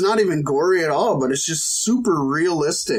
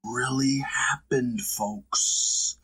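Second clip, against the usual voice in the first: whisper; 5 semitones lower, 6 dB quieter, slower than usual. Pretend. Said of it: "conspiracy tease, hushed".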